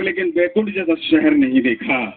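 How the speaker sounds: reciting the final line to the crowd; poem recitation. Acted. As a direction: land the last line of the verse. Couplet punchline.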